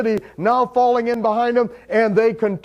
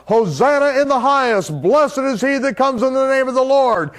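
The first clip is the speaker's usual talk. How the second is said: crying out; 5 semitones higher than usual. Retold or imitated. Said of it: "begin crying out".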